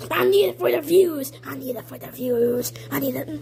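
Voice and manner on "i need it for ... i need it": softly, pitched down low; low, soft repeat. Pretend. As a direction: drop to a low, hushed voice. Same line repeated softer, lower.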